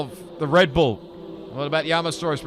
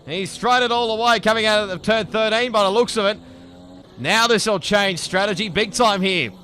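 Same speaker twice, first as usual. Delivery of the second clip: loud, high excited race call; excited motorsport commentator; 6 semitones higher, 7 dB louder than usual. Real, not acted. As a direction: shout the crash call at full volume. Crash called in live race commentary.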